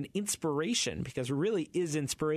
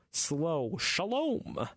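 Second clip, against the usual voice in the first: dramatic slow Shalom; 3 semitones higher, much slower than usual. Shown as a demonstration. Host mimicking the Saturday Night Live Shalom, dramatic and slow. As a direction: stretch Shalom like an SNL sketch. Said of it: "with all that drama and that slow".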